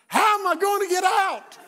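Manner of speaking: high, loud cry for a way out; hammed-up alarmed shout; panicked yell. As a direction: yell it from the bottom of a well. Acts out his panic in the well.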